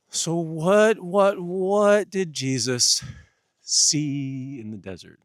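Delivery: booming slow rhetorical question; dramatic preaching. Acted. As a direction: drag the question out loud and high, like a revival preacher.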